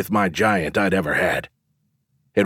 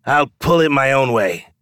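higher, defiant retort; near growl; 5 semitones higher, 3 dB louder than usual. A narrator's reading. Young challenger refuses the showman's advice, nearly growling. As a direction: push back with a touch of growl. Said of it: "he nearly growled".

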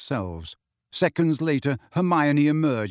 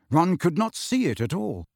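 plain reading voice

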